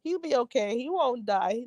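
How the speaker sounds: normal talking voice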